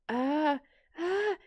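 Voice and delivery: high-pitched acted gasps of pain; imitated pained whimper. Patient holding back a scream in the chair, imitated pained whimper.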